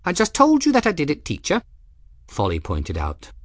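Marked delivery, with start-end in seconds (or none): 0.0-1.6 high, quick, matter-of-fact voice; young woman's voice. Acted. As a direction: point it out brightly and fast. Folly pointing out to teacher.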